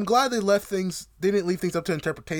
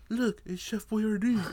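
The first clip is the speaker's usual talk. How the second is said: imitated odd Joel accent, higher and slow; slow, pitched-up mimicry of Joel's accent; weird Joel accent; 5 semitones higher, 4 dB quieter, slower than usual. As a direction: draw it out in a mock Joel accent. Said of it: "the accent he's doing".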